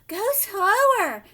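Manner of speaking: high, loud breathless plea; panting. A narrator's reading. Missus Salt out of breath from the stairs, panting. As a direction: gasp it out after the stairs. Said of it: "panted Missus Salt".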